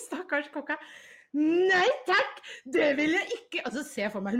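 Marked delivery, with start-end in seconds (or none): 1.4-3.6 higher and louder laughing yell; hammed-up shriek through laughter; mock scream. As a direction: shriek it while cracking up. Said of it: "bare skriker".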